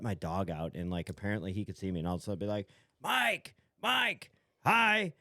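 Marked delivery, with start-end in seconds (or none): 3.0-5.1 high-pitched excited hail from afar; imitated kid calling out. Kid across the street hailing dad's friend, imitated calling out. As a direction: mimic a kid hollering for attention.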